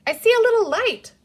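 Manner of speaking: put-on high, loud little-girl chirp; a high, loud, chirpy call. A narrator's reading. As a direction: chirp it out brightly from the water. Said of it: "Patsy chirped at her".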